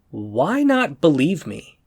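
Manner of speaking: ordinary talk, nothing marked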